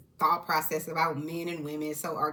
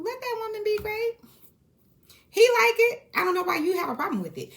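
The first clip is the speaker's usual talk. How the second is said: much higher, loud, worked-up outburst; heated exclamation; 5 dB louder than usual. Real, not acted. Vlogger defending Heavenly's marriage, high excited outburst. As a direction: shout your defence, fired up.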